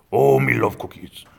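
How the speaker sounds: loud, slow gruff monster voice; hammed-up cookie-craving monster. Cookie Monster impression after naming him.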